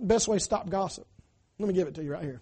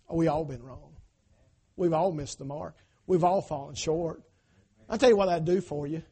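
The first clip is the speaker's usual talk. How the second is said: ordinary talk, nothing marked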